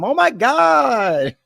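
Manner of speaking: high, long drawn-out mock cry; imitated yell. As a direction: stretch his high cry out slowly. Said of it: "It sounds the same every time".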